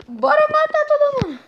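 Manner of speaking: high, put-on squeaky villain voice; hammed-up high villain cry; mock villain. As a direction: squeal it like a cartoon killer. Villain voice in game.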